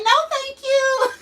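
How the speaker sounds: put-on high sing-song little-girl voice; a child brushing off mommy, sweet and high; toddler's sweet no thank you. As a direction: chirp it like a polite toddler. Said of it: "she would just keep playing and say".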